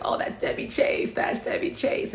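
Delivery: whispered in starstruck awe; a young dancer whispering to herself at the sight of her idol, awed. Retold or imitated. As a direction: breathe the name like seeing your idol.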